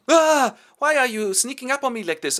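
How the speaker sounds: high, loud startled yelp; startled shriek. Acted. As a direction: jump out of your skin. Mother startled by a prank.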